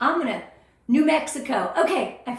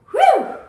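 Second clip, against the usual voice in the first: big, high, loud exhale of nerves; loud nervous whew; 13 semitones higher, 11 dB louder than usual. Real, not acted. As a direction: blow out the nerves before starting. Nervous release before starting.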